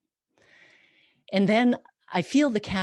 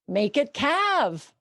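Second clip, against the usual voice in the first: demanding husband; 9 semitones higher, 7 dB louder, slower than usual. Retold or imitated. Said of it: "he's like".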